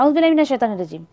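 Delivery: गबbled fast औज़ु बिल्लाहि, letters swallowed; careless rushed recitation. Shown as a demonstration. Teacher mocking students who recite carelessly, rushed mumble. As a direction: rush through it mindlessly. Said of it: "पढ़ते भी ऐसे कि अगले को समझ ही नहीं आता".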